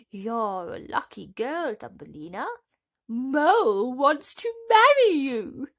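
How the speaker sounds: loud, slow, gushing announcement; gushing field mouse. A narrator's reading. The field mouse tells Thumbelina the mole wants her, loud and gushing. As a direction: sell her the happy news.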